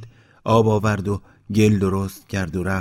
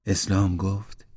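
plain reading voice

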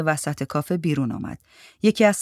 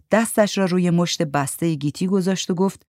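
ordinary delivery, nothing marked

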